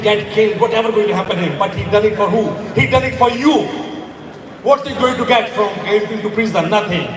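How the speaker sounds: ordinary talk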